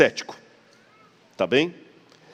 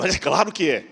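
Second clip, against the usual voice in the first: mock indignant certainty, higher; emphatic assurance; 5 semitones higher, 3 dB louder than usual. Believer replying about his god, emphatic assurance. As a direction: answer as if it were obvious. Acting listener's sure reply.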